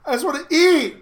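high, loud pleading imitation; mock exasperated wail. Echoing Colton's plea at the table, mock exasperated wail. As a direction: wail it like a hungry, frustrated pupil.

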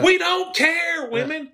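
very high, drawn-out comic plea; exasperated outburst. Acted. Men to their wives about picking seats, exasperated outburst. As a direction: cry it out slowly in mock exasperation. Mock outburst for all husbands.

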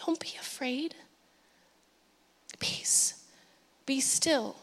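near-whisper, soft and spaced out; soft, soothing whispered reassurance; hushed calming command. Retold or imitated. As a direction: still a storm with a whisper. Voicing Jesus calming the storm.

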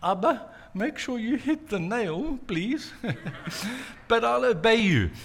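put-on high, giggly small boy; young Yeshua teasing his father in the carpentry shop, giggling; little child voice. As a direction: chirp it like a three-year-old. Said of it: "three-year-old little Yeshua said".

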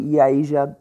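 ordinary delivery

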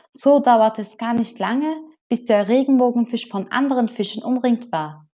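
ordinary delivery

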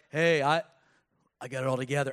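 low, put-on churchgoer voice; churchy voice. Preacher mimics churchgoers pretending all's good, low churchy voice. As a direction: put on a pious act, pitched low. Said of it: "we change our voice".

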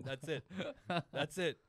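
normal talking voice

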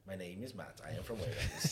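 hushed, tentative stab at Welsh; Welsh accent attempt. Shown as a demonstration. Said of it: "Can you do a Welsh accent?".

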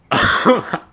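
a sudden high breathless giggle. Real, not acted. Telling friends about the call, breaks into a high giggle. Laughing at own phone story.

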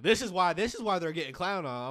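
loud exasperated retort. Retold or imitated. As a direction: call it out firmly. Scolding about the hissing girl.

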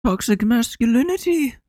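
very high mock-weepy impression; Jordan Peterson. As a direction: whine it way up high to mock him. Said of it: "crying over".

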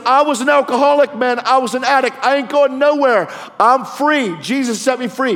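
high, loud impassioned testimony; full-force preaching. Retold or imitated. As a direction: shout it back like a fired-up preacher. Preaches his retold testimony forcefully.

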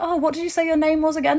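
high, quick casual question; lightly voiced distracted man; designer asking. Designer asking a fan her name again, high and offhand.

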